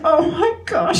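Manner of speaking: lightly acted distraught widow; understated tearful gasp; crying her eyes out. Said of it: "she was just crying her eyes out".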